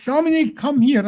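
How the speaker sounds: mock yell to summon someone over; mock screaming. Speaker pretends to summon listener from one side, mock screaming. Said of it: "and I'm talking, screaming".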